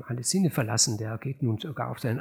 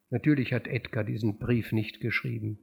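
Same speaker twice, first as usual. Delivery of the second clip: ordinary delivery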